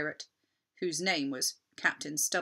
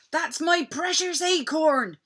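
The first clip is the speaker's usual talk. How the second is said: higher, louder joyful cry; overjoyed to spot his ship; excited cry; 10 semitones higher, 7 dB louder than usual. Acted. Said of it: "he cried".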